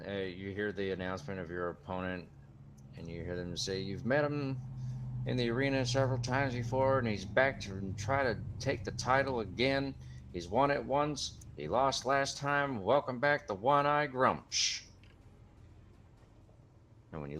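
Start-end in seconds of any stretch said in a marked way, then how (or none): 3.8-14.8 higher, hyped ring announcer, slowing on the big lines; arena announcer. Acted. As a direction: sell the champion to the crowd. Arena announcer introduces the orc.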